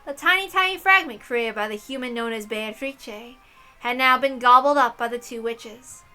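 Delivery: ordinary delivery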